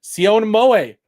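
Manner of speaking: ordinary talk, nothing marked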